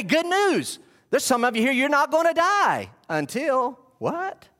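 building from deliberate reading to high-pitched proclamation; emphatic preaching read-aloud. Real, not acted. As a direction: hammer the key words, climbing in pitch. Reads commentary aloud, preaching it.

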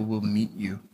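hushed whisper, barely voiced. Pastor whispering comfort to grieving listeners. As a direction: breathe the words out like a secret comfort. Tender close of the comfort passage.

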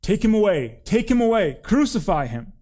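high, loud mob cry; insistent shouted chant, each cry falling. A narrator's reading. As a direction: demand it as an angry crowd would. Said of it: "And they shouted".

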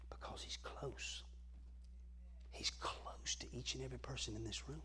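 very soft, near-whispered voice; hushed closeness. Shown as a demonstration. Preacher telling the room God is close, hushed. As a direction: drop to an intimate near-whisper.

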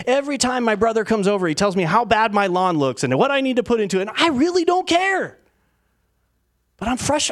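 high-pitched, defensive griping; acted exasperation. Complaining about a brother's lawn remarks, hammed-up exasperation. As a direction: insist you're fine while clearly worked up.

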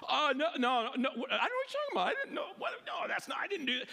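mock panic, very high-pitched and stammering; flustered denial. Imagined first response when confronted, flustered denial. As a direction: squeal out a hammed-up protest of innocence.

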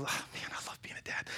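hushed, near-whispered fondness; tender aside. Real, not acted. Dad talking about his young daughter, tender. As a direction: let it drop to a soft, heartfelt murmur.

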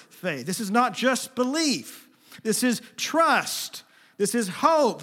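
loud, high preaching build; rising sermon fervour; preaching at full force. Acted. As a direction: drive each word home to the congregation.

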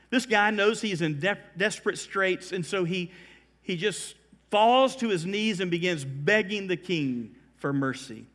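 ordinary talk

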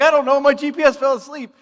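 high, loud helpless excuse; defensive protest. Retold or imitated. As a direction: plead it loudly in self-defence. Defending himself to dad.

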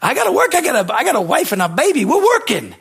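put-on young worker insists to his boss he must keep working; desperate insistence. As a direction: plead to keep working, pushing hard. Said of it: "I'm like".

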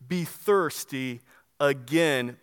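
thirsty again drawn out very slowly and low; slow solemn close on thirsty again; slow emphatic finish. Acted. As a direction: land it slowly, word by word. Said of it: "all caps, bold".

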